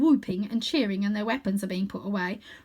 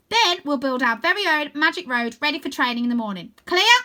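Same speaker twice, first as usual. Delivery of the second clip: higher, louder commanding voice; bossy chief; 6 semitones higher, 7 dB louder than usual. A narrator's reading. Stella orders her girls to build the road, bossy. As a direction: bark the plan and demand an answer.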